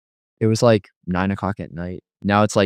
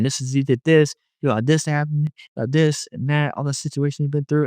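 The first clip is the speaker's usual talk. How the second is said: raised-pitch listing of grievances, re-enacted; retold accusing rant; 6 semitones higher than usual. Pouring out everything he went through, accusing. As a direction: run through the blame in a higher voice. Retold venting of blame at God.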